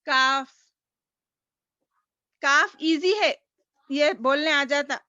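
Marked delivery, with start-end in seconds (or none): 0.0-0.5 "کاف" said gently, k forward in the mouth; soft kaf. Shown as a demonstration. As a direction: ease out "کاف" softly. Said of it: "نرمی سے بولنا ہے".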